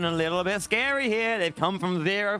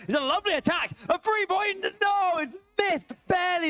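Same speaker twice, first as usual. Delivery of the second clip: excited shout; 11 semitones higher than usual. Real, not acted. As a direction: yell the near-miss like a live caster. Caster calls a near three-pointer.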